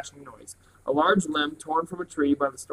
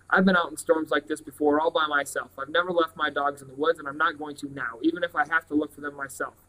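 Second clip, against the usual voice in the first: plain reading voice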